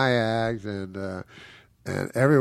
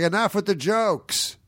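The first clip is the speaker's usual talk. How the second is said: heckler, mock; 11 semitones higher, slower than usual. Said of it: "he's like".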